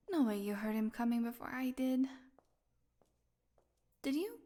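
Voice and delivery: ordinary delivery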